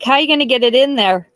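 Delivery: incredulous question. Retold or imitated. To the aunt with the raccoon, fast incredulous question. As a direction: fire off the question in disbelief. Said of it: "And I'm like".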